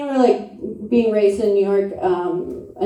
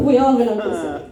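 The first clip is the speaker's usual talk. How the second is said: ordinary talk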